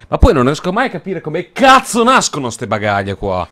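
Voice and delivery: ordinary delivery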